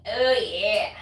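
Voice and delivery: booming mascot "Oh, yeah"; a light take on the juice mascot's cry; Kool-Aid Man. Retold or imitated. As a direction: bellow it like the pitcher bursting through a wall. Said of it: "Like the Kool-Aid man".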